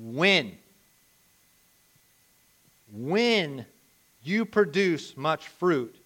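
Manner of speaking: "when" set apart by a long pause and repeated; slow, weighty emphasis on "when"; stress on "when". Real, not acted. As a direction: land firmly on "when", drawn out. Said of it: "I wanna stress this word right here".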